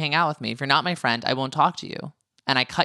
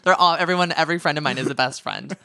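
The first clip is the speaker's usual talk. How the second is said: ordinary talk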